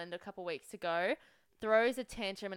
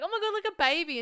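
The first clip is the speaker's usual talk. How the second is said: high excited squeal; excited gasp; 9 semitones higher, 6 dB louder, faster than usual. Retold or imitated. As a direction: gush over a baby. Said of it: "I was like".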